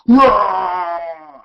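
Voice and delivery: loud playful roar; mock roar. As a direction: roar it out to scare. Devil filter, scaring.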